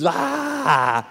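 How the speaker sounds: sudden high yelled "Ah!"; demoniac's scream. Retold or imitated. Preacher voicing the tomb man screaming at Jesus, high yell. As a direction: let out a wild possessed scream. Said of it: "and he screams".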